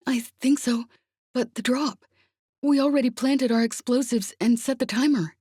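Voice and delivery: high, urgent, breathless report; frantic. A narrator's reading. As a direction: rush out bad news in panic. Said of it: "he sounded frantic".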